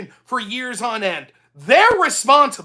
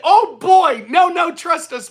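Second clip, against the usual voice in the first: higher, louder sneering mock of a politician's promise; mocking whine; 7 semitones higher, 5 dB louder, slower than usual. Mocking politicians who claimed to care about the border, sneering high mock plea. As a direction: ham up a fake reassuring plea, slow on the nos. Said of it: "they said".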